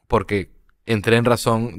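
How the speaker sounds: ordinary talk, nothing marked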